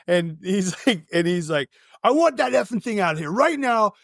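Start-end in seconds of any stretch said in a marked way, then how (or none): 2.0-3.9 mock angry demand, higher-pitched; hammed-up irate ordering. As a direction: imitate a worked-up man ordering someone out. Retold guy yelling demands.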